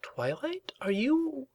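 high, delicate, careful question; hesitant concern. A narrator's reading. Rarity checks on Twilight, hesitant and concerned. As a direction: broach it gingerly, left hanging. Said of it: "Rarity gave a concerned twinge".

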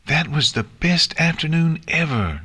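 high-pitched happy character cheer. A narrator's reading. Nix after an afternoon out with Twilight, cheering. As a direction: cheer it high, without extra volume. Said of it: "Nix cheered".

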